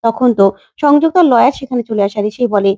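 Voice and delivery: ordinary delivery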